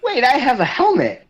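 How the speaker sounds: high, loud burst of realization; excited high-pitched outburst; sudden realization. Acted. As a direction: blurt it out high and loud, as if just remembering. Player suddenly remembers the character's helmet.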